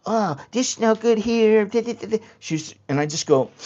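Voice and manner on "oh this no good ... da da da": Thai teller in broken English; mock Thai-English teller. Quoting Thai bank teller.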